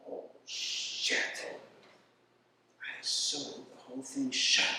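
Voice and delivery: soft, slow horrified mutter; under-breath oh no; muttered dismay. Retold or imitated. Realizes mistake.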